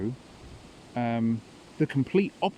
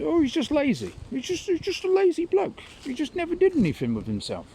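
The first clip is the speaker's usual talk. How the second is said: much higher mock voice of Tom; Tom dismissing his son. Tom brushes off his hermit son, high mock voice. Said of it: "he just called his son".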